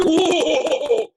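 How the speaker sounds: loud, high "whoa" with a laugh; big hammed-up swooping "oh"; mock excited whoop. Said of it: "and I go".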